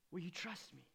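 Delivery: Jesus asking Martha softly; hushed question. Acted. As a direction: drop to a near-whisper ask. Quiet close after plea.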